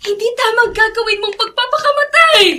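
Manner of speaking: alarmed shouting; urgent shout. Acted. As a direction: shout to stop her grabbing the knife. Stopping a suicide attempt.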